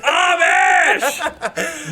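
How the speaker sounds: a whoop of laughter on the word; laughing shout. Real, not acted. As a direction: yell it out laughing at the discovery.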